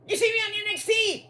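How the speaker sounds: put-on very high, girlish question; spoiled daughter voice. Pretend daughter asking her father, a very high girlish voice. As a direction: ask Daddy eagerly, sweetly. Voicing Tiffy to Daddy.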